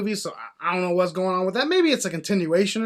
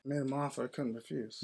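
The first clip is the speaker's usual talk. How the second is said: very soft, lowered Godfather voice; mob boss impression; 6 semitones lower, 15 dB quieter than usual. Answering co-host's challenge to do it, hushed impression. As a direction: murmur it like the old don. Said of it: "Can you do it?".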